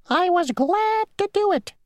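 very high, squeaky insect voice; a tiny, prim rule-keeper; a tiny mosquito insisting on his rule. Acted. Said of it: "said the mosquito".

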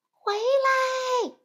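put-on high, loud childlike cry; shouting. A narrator's reading. Dorothy yells at the balloon to come back, high and loud. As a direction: yell after the rising balloon. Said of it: "他大叫道".

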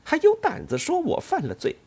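high scornful retort; disgusted. Acted. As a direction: sneer with contempt. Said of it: "他厌恶地说着".

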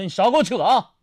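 high, loud comic outburst; mock fury. Customer telling off the waiter over wine, high mock-angry demand. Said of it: "当时我一听我就火了".

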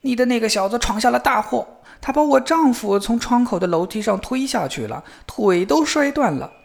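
high, outraged wife's tirade; yelling accusation. A narrator's reading. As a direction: berate the father about his troublemaking son. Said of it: "对着他大喊大叫".

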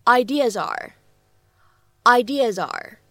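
"Ideas are" linked into one smooth "ideasare"; "Ideas are" run together with no break; linking demo. Shown as a demonstration. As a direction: join "Ideas" straight into "are". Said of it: "我们呢可以读成是".

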